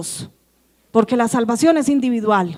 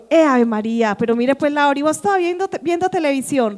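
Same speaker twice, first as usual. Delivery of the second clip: usual speaking voice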